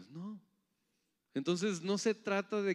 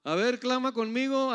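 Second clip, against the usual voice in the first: high, loud urging; rally the crowd to join in; rallying call; 9 semitones higher, 7 dB louder than usual. Real, not acted. Preacher to congregation before crying out, loud rallying.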